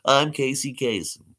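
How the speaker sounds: Casey Kasem. Shown as a demonstration. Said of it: "I do voices".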